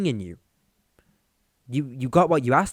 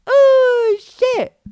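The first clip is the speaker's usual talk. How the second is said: a sudden very high, loud squeak of alarm; startled squeal; 10 dB louder than usual. Real, not acted. Realising the joke went too far, shrieking in shock. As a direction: yelp as if you just said too much. Catches himself after offensive joke.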